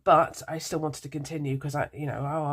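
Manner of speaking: ordinary talk, nothing marked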